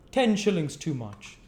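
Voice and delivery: high, loud dismissive aside; sniffy gentleman. Acted. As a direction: scoff at the price.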